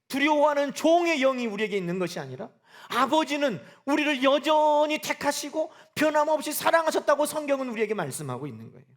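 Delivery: normal talking voice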